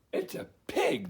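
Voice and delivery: high, startled exclamation; surprised cry. Acted. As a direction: shout the shock together. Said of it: "they cried".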